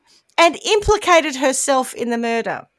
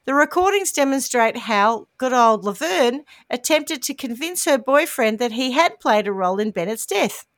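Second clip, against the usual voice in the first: ordinary delivery, nothing marked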